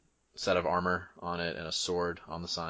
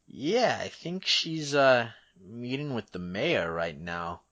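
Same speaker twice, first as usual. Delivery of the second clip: higher, hedging guard voice; casual guard character; 4 semitones higher, 3 dB louder than usual. Acted. Guard tells visitor of captain's whereabouts, casually hedging. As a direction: think aloud in a raised voice, then settle.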